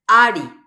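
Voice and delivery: Ari with a loud first A; stress on A. Shown as a demonstration. Teacher showing the tonic vowel, loud stressed A in Ari. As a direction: hit the opening A of Ari hard. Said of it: "la vocal tónica es la A".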